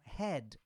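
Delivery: head ending in a dry D with no vowel; dry final D. Shown as a demonstration. Teacher models head for Brazilian learners. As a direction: stop head cleanly on the D. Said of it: "é bem seco".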